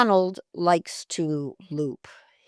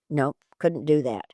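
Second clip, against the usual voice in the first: ordinary talk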